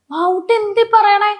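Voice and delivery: high, slow, teasing imitation of her husband; husband's reply. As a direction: drawl it out high like a fond husband. Husband's reply retold by wife. (ഭർത്താവിന്റെ ഉയർന്ന, സാവധാനത്തിലുള്ള അനുകരണം)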